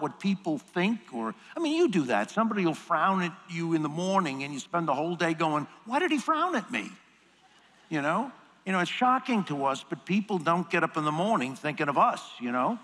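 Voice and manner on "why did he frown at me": high, fretful worrying voice, pitched up; a fussy self-doubting whine; anxious inner voice. Retold or imitated. To himself after a frown, fretful high whine. Fretting over a frown all day.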